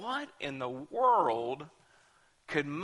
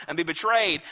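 normal talking voice

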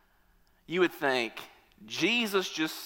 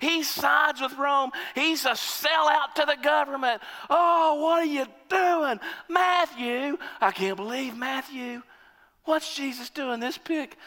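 right-wing fans protesting Matthew; indignant protest; 8 semitones higher than usual. Retold or imitated. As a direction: protest the pick in disbelief. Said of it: "right-wingers are going".

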